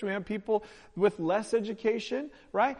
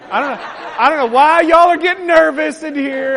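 laughing; 5 semitones higher, 11 dB louder than usual. Real, not acted. Teasing nervous crowd, laughing.

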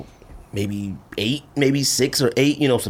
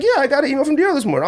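quick, higher-pitched casual wife reply; voicing wife; 11 semitones higher, faster than usual. Wife answering her husband, quick and higher. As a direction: toss it off lightly, pitched up.

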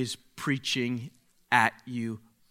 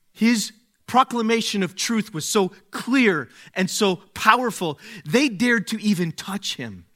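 higher, ringing proclamation; forceful preaching; 6 semitones higher, 4 dB louder than usual. Acted. Pastor to congregation on Jesus' power, preaching strongly. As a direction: drive the point home from the pulpit.

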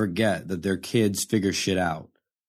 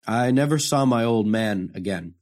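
usual speaking voice

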